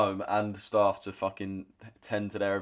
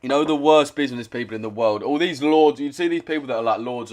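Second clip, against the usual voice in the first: louder and higher, ranting in disbelief; 4 semitones higher, 6 dB louder than usual. Real, not acted. Streamer ranting to chat about useless lords, loud and heated. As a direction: blast it like a fed-up rant.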